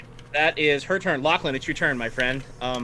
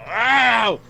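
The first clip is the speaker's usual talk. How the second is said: higher, louder mock ow; mock pained yelp; 7 semitones higher, 6 dB louder than usual. Villain lady yelping as she gets up, mock pained. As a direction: yelp it like a cartoon hurt. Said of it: "she's like".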